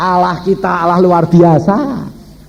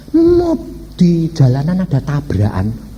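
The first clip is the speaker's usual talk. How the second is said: normal talking voice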